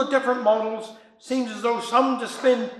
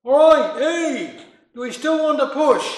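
louder, higher call into the dark; shouting out; 5 semitones higher, 6 dB louder than usual. A narrator's reading. Husband calling out into the night for the stranger. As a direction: call out to someone you can't see. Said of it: "So he shouted".

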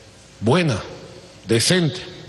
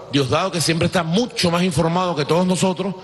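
ordinary talk

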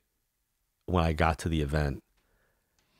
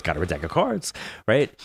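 normal talking voice

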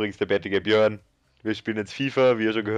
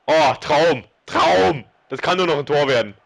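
loud, high hyped commentary; excited; 6 semitones higher, 7 dB louder, slower than usual. Real, not acted. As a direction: cheer the play on loudly. Big chance in match.

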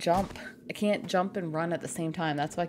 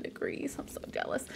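whispering; 10 semitones higher, 9 dB quieter than usual. Real, not acted. Whispered aside reading chat.

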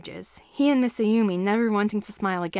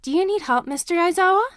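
put-on higher, bright child voice asking politely; a small kid tugging at the teacher's sleeve; eager little boy; 9 semitones higher, 7 dB louder than usual.

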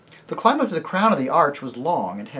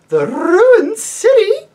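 high, loud, slow startled question; taken aback, drawn out; lady surprised by the children's goal; 14 dB louder, slower than usual. Acted.

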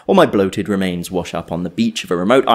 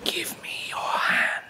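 mocking whisper; 9 dB quieter, slower than usual. Man mimics the mermaid's whisper, mocking. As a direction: imitate her slow eerie whisper. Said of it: "Like that?".

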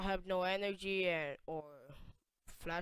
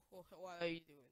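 usual speaking voice

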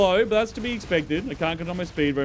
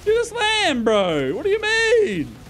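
outraged; 11 semitones higher, slower than usual. Real, not acted. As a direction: yell in disbelief. Furious repeat.

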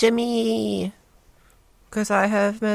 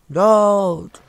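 impatient shout; 9 dB louder than usual. Real, not acted. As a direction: shout "Load" loud with a falling tune. Impatient at loading screen.

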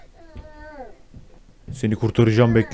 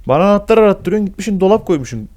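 higher, louder scolding; indignant complaint; 7 semitones higher, 4 dB louder than usual. Real, not acted. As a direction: tell him off in outrage. Scolding the game character.

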